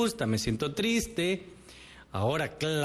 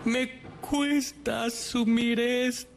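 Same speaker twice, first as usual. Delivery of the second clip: llanto fingido; 7 semitones higher, slower than usual. Pretend. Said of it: "Voy a llorar".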